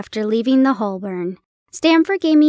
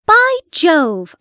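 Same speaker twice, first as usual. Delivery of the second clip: a raised exclamation of surprise; cried out; 4 semitones higher, 4 dB louder than usual. A narrator's reading. Watson crying out at the coincidence, raised exclaim. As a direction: call it out, struck by the coincidence. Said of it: "I cried".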